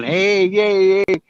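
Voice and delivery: high, drawn-out mock cheer; imitated crowd yelling. Imitating everyone yelling at the car in the parking lot, mock cheer. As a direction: imitate a crowd hollering without full volume. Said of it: "Everyone in this parking lot's yelling".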